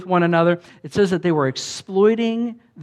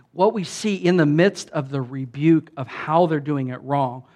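ordinary talk

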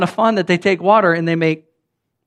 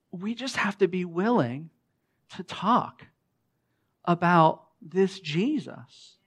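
much softer, slow, intimate plea; soft preaching close; 9 dB quieter, slower than usual. Acted. Preacher to congregation, hushed and slow. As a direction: drop to a hush and draw it out.

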